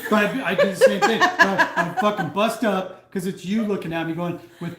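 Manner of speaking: talking through laughter, high and loud; can't stop laughing while explaining; breaking up mid-sentence. Real, not acted. Laughing while telling story.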